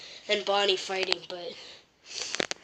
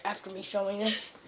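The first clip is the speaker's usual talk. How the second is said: ordinary delivery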